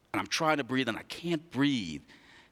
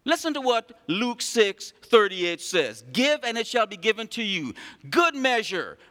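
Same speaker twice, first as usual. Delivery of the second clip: raised, ringing pulpit voice; full-force preaching; 8 semitones higher, 4 dB louder than usual. Acted. Pastor preaching to the church, raised and ringing. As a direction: preach it out to the congregation.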